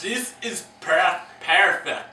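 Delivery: "perfect" in a slow, put-on Scottish accent. Host attempting Scottish for cohost, slow Scottish accent. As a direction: try on a Scottish accent carefully. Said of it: "You don't have to roll your R's".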